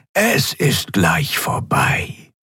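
slow, breathy alien murmur; nonhuman voice. A narrator's reading. Alien medic calming the admiral, a nonhuman murmur. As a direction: soothe him like something not human. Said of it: "fast wie eine Drohung".

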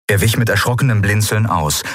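ordinary delivery

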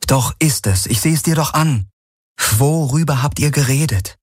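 high, persistent challenge; insistent Kirai. Acted. Kirai voiced, insisting on an answer.

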